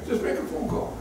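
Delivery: soft, breathy repeat; near-whisper urging. Acted. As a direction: drop to a hush. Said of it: "repeat contrast".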